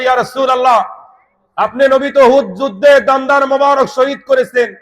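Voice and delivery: high-pitched sermon delivery; impassioned preaching. Real, not acted. Preaches at full force. (উঁচু গলায় আবেগী ওয়াজ)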